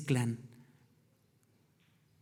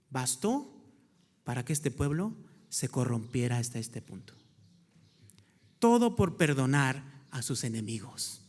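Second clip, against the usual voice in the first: drops low and soft, lets the weight sink in; hushed grave tone; 6 semitones lower, 6 dB quieter than usual. Acted. Preacher warns the congregation, hushed and grave. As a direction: say it quietly, like a warning. Grave point after a pause.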